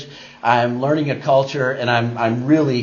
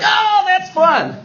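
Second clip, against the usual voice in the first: very high, drawn-out mock delight; mocking amusement; 8 semitones higher, slower than usual. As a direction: imitate coworkers gleefully making fun. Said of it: "they couldn't stop laughing at".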